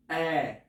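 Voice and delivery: "E" held as a long open German Ä, eh; vowel demo. Shown as a demonstration. Teacher leading students to repeat the umlaut Ä. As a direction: model "E" for the class to repeat. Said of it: "repetid conmigo".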